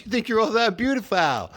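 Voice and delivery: high, sneering imitation of the daughter; mocking daughter. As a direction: mimic her taunt in a high voice. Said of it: "She goes".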